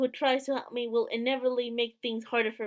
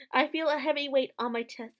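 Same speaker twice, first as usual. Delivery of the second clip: usual speaking voice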